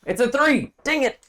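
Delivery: high, loud exasperated cry at bad roll; frustrated yelp. Real, not acted. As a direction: yelp in mock despair.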